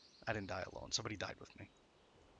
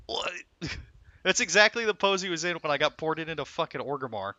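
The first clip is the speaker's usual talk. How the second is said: ordinary delivery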